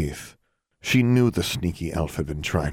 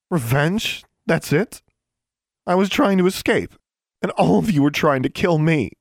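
put-on much higher, louder indignant girl; outraged protest; 12 semitones higher, 5 dB louder than usual. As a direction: near tears, crying out at the unfairness.

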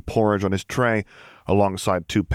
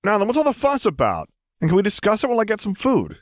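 lightly voiced young man, higher; a light, easygoing higher character voice; Luke's voice; 14 semitones higher than usual. A narrator's reading. Luke asking troopers what the fuss is, light and casual. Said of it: "he asked".

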